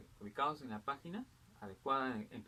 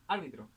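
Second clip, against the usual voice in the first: árbitro stressed on ÁR; stress on first syllable. Shown as a demonstration. Teacher showing students a movable accent, árbitro stressed on ÁR. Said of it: "el acento es libre, variable".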